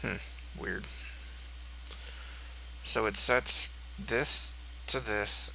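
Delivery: ordinary delivery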